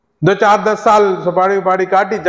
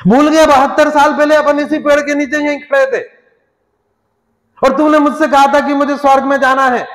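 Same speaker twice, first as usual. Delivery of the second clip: scolding; 7 semitones higher, 4 dB louder than usual. Acted. God scolding the dead man.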